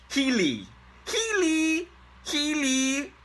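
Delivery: high squeaky Healy, Healy, Healy; mock a silly squeaky hello. Shown as a demonstration. Teacher mocking how it sounds to him, high squeaky Healy. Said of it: "it sounds like".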